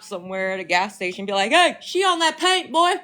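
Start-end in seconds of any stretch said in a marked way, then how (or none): 1.5-2.9 high-pitched mock call to a stranger; playful calling out. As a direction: hail someone in a put-on high voice. Imagined call to gas station stranger.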